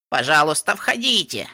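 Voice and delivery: put-on rattly elderly mare inviting guests in; old mare voice. A narrator's reading. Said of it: "дребезжащий голос пожилой кобылы".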